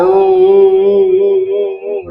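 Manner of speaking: put-on high, slow wailing ओम like a crying child; teacher imitating a child crying ओम. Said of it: "जैसे कोई बच्चा रोता है".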